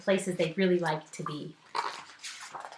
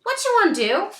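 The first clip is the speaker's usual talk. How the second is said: put-on much higher, louder kid voice; little brother voice; 13 semitones higher, 8 dB louder than usual. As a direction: chirp it like a bored little boy.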